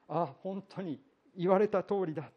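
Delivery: a hint of the shock that leads to tears; dismayed realization. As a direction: voice the sudden, horrified realization. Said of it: "思い起こして泣き崩れた".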